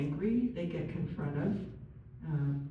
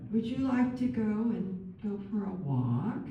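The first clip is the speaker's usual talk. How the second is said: put-on baby talk; 3 semitones higher than usual. Shown as a demonstration. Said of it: "baby talk".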